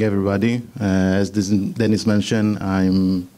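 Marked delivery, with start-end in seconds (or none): none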